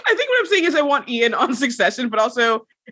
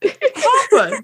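mock papa; 10 semitones higher, 3 dB louder than usual. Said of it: "Her papa".

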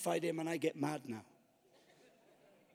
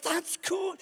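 high, thrilled approval; mock-excited high voice; delighted inner thought; 9 semitones higher, slower than usual. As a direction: cheer it on like a fan. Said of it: "I thought".

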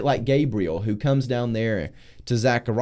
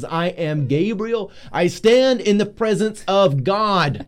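slow, louder, higher angelic declaration; booming angel Gabriel; 6 semitones higher, 4 dB louder, slower than usual. Acted. Gabriel announcing himself to doubting Zechariah, slow and booming. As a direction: proclaim it slowly like an angel standing before God. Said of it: "when you were emphasizing how Gabriel talked, like, you, you got louder and slower".

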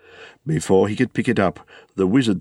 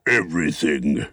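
low, solid dwarf reply; dwarves answering; 5 semitones lower than usual. Acted. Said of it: "they answered".

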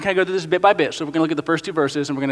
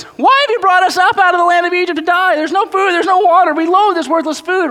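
much higher, louder whining Israelites; whiny complaint; 10 semitones higher, 4 dB louder than usual. Acted. Pastor acts out the Israelites griping at Moses, high and whiny. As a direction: complain bitterly like starving wanderers.

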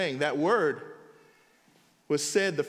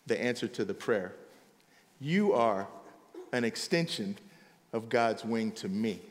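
tender confession; 10 semitones lower, 5 dB quieter than usual. Retold or imitated. Ruth softly telling Boaz he answers her prayer, tender and low. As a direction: speak it gently, like a heartfelt thank you.

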